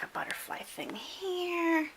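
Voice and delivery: whispering. Real, not acted. Hushed pointing aside.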